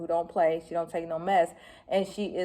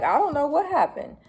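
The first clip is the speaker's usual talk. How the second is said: mock clueless partner; 9 semitones higher, 4 dB louder than usual. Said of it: "your boo was like".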